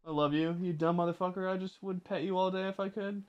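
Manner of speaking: soft, doting murmur to a dog; hushed loving baby talk; tender pet talk. Pretend. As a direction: coo affectionate insults quietly.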